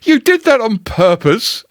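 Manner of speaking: high, spluttering Piglet voice. Acted. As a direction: choke out the words through soap. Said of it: "spluttered Piglet".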